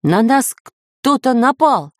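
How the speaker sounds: high, slow urgent cry; serious cry. A narrator's reading. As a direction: call out the alarm in earnest. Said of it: "серьёзно крикнул Самоделкин".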